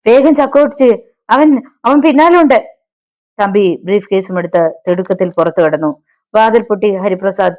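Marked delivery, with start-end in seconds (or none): 0.1-2.7 high-pitched, urgent panic; trembling with fear. A narrator's reading. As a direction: urge him on like a hunted man. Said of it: "തമ്പിയുടെ ശബ്ദം വിറച്ചു".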